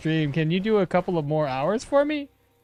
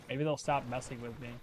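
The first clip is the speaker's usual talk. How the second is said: usual speaking voice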